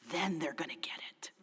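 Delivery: hushed, breathy whisper; whispered hope. Retold or imitated. Pastor whispering her anxious hope to herself. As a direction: whisper it like a secret wish. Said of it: "Because I always felt like".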